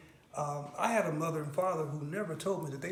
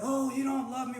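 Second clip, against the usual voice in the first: higher, louder mock yell of an upset mother; raised-voice retort, retold; 7 semitones higher, 5 dB louder than usual. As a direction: imitate mom yelling back a protest. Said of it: "she started yelling at me".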